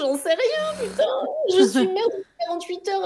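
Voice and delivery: outraged new mum half laughing; exasperated outburst. Real, not acted. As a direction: blurt it out in disbelief.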